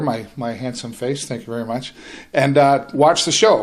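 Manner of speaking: ordinary talk, nothing marked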